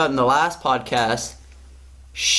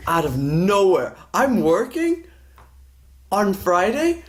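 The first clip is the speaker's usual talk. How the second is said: normal talking voice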